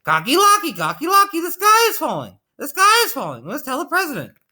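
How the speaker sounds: squealed, urgent poultry alarm; high yelling. A narrator's reading. Three birds alerting their friend Cocky Locky, yelling high. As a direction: yell it way up high in a panic. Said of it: "tell their friend Cocky Locky and yelled".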